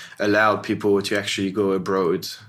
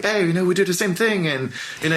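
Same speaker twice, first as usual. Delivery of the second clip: higher, quicker self-quote; mock-eager claim; 9 semitones higher, faster than usual. Designer imagining himself claiming London matches New York, mock-eager. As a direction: toss it out brightly as an imagined pitch.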